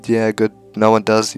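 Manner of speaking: ordinary talk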